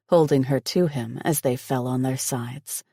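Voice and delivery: usual speaking voice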